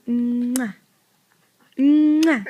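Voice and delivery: big smacking kisses; loud kiss sounds. Shown as a demonstration. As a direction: kiss noises for the toddler. Mother shows kiss sound.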